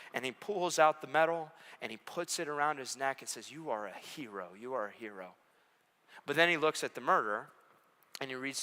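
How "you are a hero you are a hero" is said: soft, quiet honouring; tender praise. Acted. Judge honouring the hero, soft and tender. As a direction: hang the medal on him gently.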